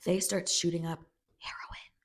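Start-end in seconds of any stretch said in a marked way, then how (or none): 1.4-1.9 whispered word. Retold or imitated. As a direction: hush it like a secret.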